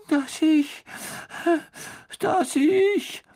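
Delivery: hammed-up, very high despairing wail; sobbing ghost girl. A narrator's reading. Ghost tells how Peeves drove her to despair, high sobbing wail. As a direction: wail in despair, breaking off between sobs. Narrator voices sobbing Myrte.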